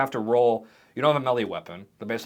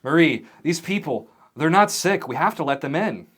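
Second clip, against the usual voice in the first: raised, earnest appeal; persuading coworker; 5 semitones higher, 6 dB louder than usual. Acted. Peter to Marie, arguing to let them in, earnest persuading. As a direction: urge a colleague to relent. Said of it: "and he goes".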